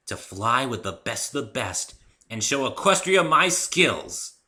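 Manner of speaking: high, slow and starry-eyed; dreamy. A narrator's reading. Rainbow Dash daydreams about flying, slow and dreamy. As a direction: drift off into the dream. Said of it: "her dreamy look".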